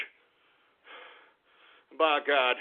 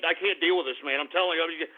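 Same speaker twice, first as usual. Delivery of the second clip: ordinary talk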